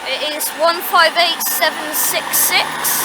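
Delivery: loud, slow tannoy announcement. Pretend. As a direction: boom it across the platform slowly. Booms the unit number.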